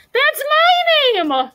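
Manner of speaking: high, loud, drawn-out outburst; excited cry. A narrator's reading. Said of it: "Dominic cried".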